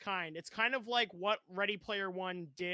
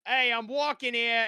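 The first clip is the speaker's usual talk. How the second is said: loud Brooklyn tough guy; Brooklyn accent; 3 semitones higher, 9 dB louder than usual. Retold or imitated. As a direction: bark it in a New York accent. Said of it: "he does a Brooklyn accent so well".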